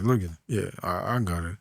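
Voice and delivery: low, quiet, stoic murmur; straight-faced calm. Acted. Man carrying bags while being admired, straight-faced. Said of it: "it's like straight face".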